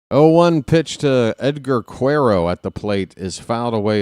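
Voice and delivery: ordinary delivery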